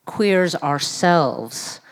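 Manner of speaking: ordinary talk, nothing marked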